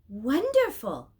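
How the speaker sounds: loud, slow delighted cry; a child angel bursting with delight; exclaiming. A narrator's reading. Child angel greeting the pilgrims, slow delighted exclamation. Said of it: "he exclaimed".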